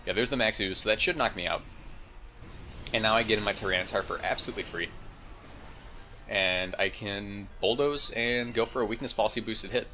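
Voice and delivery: ordinary delivery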